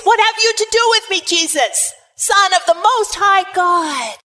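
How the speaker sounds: high, loud demon's outcry; loud cry. Acted. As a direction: shout it as the possessed man. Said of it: "he said in a loud voice".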